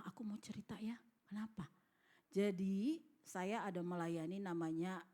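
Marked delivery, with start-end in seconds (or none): 0.1-1.7 very soft, near-whispered aside; acted-out timid, hushed exchange; retold hushed confiding. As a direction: confide it under your breath, as if retelling. Wife nervously confides in husband.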